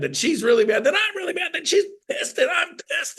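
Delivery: very high, squealed mock fury; escalating mock anger. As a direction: spiral up into a comic high-pitched tirade. Acts out the escalating mad cycle.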